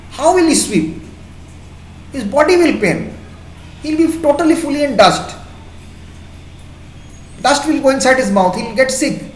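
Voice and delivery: emotional pity. Real, not acted. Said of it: "I'm getting very emotional with this".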